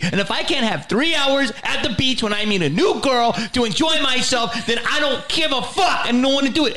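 high-pitched, heated imitation of a rant; losing his mind. Comic imitates writing partner defending his beach time, furious high rant. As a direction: pile up grievances in a mock outburst. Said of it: "And you lost your mind".

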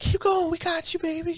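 high, soft encouraging coo; sweet cheering woman voice. Retold or imitated. Two women in crowd cheering him, high coo. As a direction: coo support like a fan.

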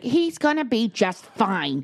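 slow, drawn-out brush-off; dismissive reassurance. Retold or imitated. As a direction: imitate a mother waving worry away. Grandmother brushing off worry, retold.

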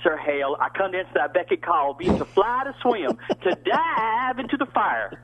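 high, declaimed Ariel in a Southern drawl; hammed-up Southern stage verse; thick Southern-accented Shakespeare. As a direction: overplay Shakespeare with a Southern accent, stretching the last line. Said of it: "Your accent".

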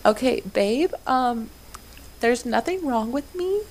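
mock tearful plea to her husband; teary warning. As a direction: replay holding back tears for her husband. Warning husband she'll cry.

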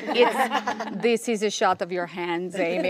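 mock the director's accent through a laugh; bad French Canadian accent. Actress imitating her director, laughing French Canadian accent. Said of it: "my bad French Canadian accent".

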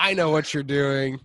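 slow, knowing deadpan; lightly voiced, slow and knowing; knowing Hader. Bill Hader catching Peter on the call, slow deadpan. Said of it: "And Bill Hader says".

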